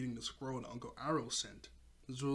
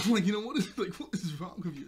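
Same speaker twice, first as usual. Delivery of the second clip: high, loud reproach through laughter; tickled, exasperated telling-off; laughing mock scolding; 6 semitones higher, 9 dB louder than usual. Mom rebuking Azula for disrespect, laughingly exasperated.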